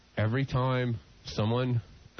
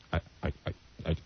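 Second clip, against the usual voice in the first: much lower, stuttering voice of a woman explaining her tic; imitating the woman; 9 semitones lower, faster than usual. Blind man voicing the train passenger explaining herself, low and stammering. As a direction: drop your voice and stammer it out. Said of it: "and then she goes".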